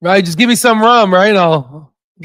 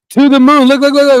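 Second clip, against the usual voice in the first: higher and louder, pumped; hype shout; 6 semitones higher, 5 dB louder than usual. Real, not acted. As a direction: cheer the stock on. Hyping the stock rising.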